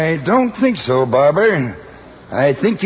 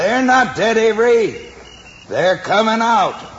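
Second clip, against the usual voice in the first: calling out; 10 semitones higher than usual. Acted. Calling out to Avery.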